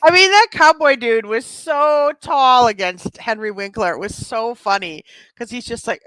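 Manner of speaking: ordinary talk, nothing marked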